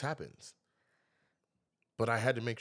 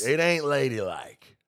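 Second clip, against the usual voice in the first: loud slow repeat of the scolding; teasing scold; 3 semitones higher, 8 dB louder, slower than usual. Real, not acted. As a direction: stretch ain't with mock disapproval.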